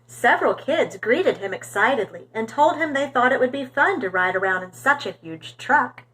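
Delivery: ordinary delivery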